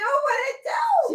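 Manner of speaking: high, drawn-out shouted "What it do?"; shouted greeting. Acted. Cohost echoing the host's greeting, hollered high and drawn out.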